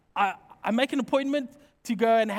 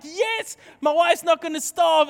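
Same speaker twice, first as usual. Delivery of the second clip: much higher, louder cheer of relief; excited relief; 5 semitones higher, 4 dB louder than usual. Retold or imitated. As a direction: cheer that the wife gets her hake. Relief that wife can eat.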